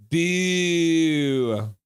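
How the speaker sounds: long, drawn-out jeer; booing. Acted. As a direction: boo like a fan in the stands. Booing along with the chat.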